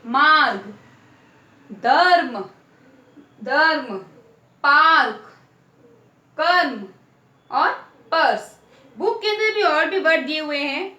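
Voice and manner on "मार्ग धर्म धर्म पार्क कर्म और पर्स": मार्ग, धर्म, पार्क, कर्म, पर्स each called out louder, the र clearly sounded; louder teacher demo of each word, with a pause after every one. Shown as a demonstration. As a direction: announce each word firmly to the class. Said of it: "क्या पढ़ेंगे?".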